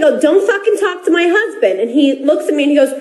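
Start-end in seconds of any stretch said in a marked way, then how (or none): 0.2-1.8 raised, pitched-up telling-off; retold angry retort. Wife reenacting her courtside retort to LeBron, higher heated snap. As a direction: snap back at him as she did at the game.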